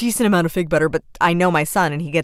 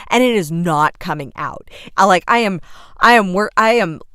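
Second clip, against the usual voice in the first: ordinary talk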